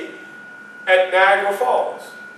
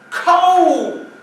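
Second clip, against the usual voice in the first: high, loud burst of cold; exclaimed cold; 10 semitones higher, 4 dB louder than usual. Acted. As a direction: cry out the word cold, much higher. Recalling freezing voyage.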